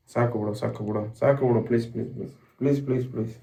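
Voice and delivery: choked soft begging. Real, not acted. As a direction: beg under your breath, near tears. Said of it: "ನಂಗ್ ಅಳು ಬರುತ್ತೆ ಸುಮ್ನೆ".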